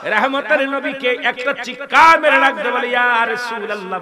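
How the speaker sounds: high, loud fiery sermon voice; preaching at full force. Acted. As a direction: preach it out with fire. Preacher in full force telling story.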